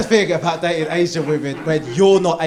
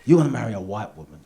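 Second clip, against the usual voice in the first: gruff uncle voice; 6 semitones lower, 4 dB quieter, faster than usual. Retold or imitated. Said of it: "He goes".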